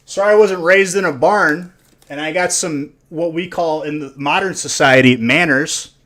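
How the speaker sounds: loud, high-pitched mock outrage; mock indignation. Streamer scolding a viewer over manners, mock indignant and loud. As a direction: belt out a hammed-up haughty retort.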